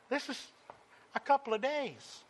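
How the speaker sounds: usual speaking voice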